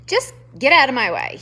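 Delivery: fast, loud gruff snarl. A narrator's reading. Porcupine snarling at the mouse, loud and fast. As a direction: shove the words out, annoyed. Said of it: "he snarled".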